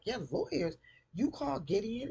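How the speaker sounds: high-pitched accusing questions; incredulous mock challenge; acted confrontation. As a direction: act out a confrontation, voice pitched right up. Acted confrontation between the two sisters.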